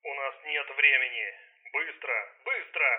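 firm commanding order, urging haste. A narrator's reading. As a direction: give a firm, urgent order. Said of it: "Повелительный голос Райма". (повелительный тон)

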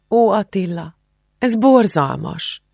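high, slow lament; tearful breakdown. A narrator's reading. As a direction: voice the anguish, drawing out the words. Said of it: "elfojtott zokogás feltört a torkából".

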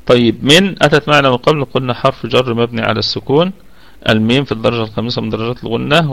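ordinary talk

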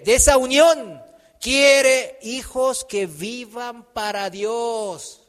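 loud, slow, drawn-out declamation; ringing pulpit reading, words stretched out; preaching scripture. Real, not acted. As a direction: proclaim the verse with full force.

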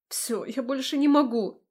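exasperated, airy admission of defeat; giving up. A narrator's reading. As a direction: give in, worn out by the effort. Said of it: "Сдалась я".

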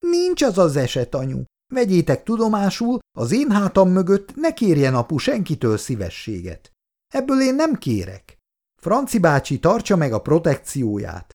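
high-pitched, indignant and defiant; worked-up refusal. Acted. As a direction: throw it at them, fed up and hurt. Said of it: "Icu úgy felhúzta magát". (felháborodott, magas hangú elutasítás)

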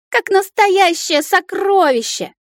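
put-on high, drawn-out childish squeal; high little-dragon whine; shrieking child. A narrator's reading. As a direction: wail it slowly in excited protest. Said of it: "верещало дитя".

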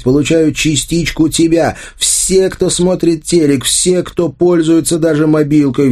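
high, impassioned build, easing late; mounting accusation. A narrator's reading. Anya accuses Arsen of spreading the games, impassioned.

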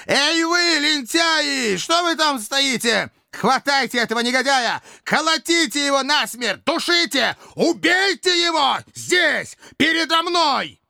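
very high, frenzied shouted orders; hoarse frantic screaming. A narrator's reading. Dictator orders slaves to kill the man, frenzied screaming. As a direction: scream the commands as if out of your mind. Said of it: "закричал рабам хриплым, неистовым голосом".

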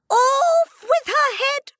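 high, loud, slow scream; the Queen screaming at Alice. Acted. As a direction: scream the order in royal fury. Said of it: "screamed the Queen".

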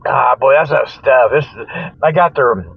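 ordinary talk

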